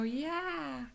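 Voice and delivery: high, drawn-out delight; eager yes. Retold or imitated. Excited child accepting mom's snacks, eager yes. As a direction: answer eagerly, stretching it high.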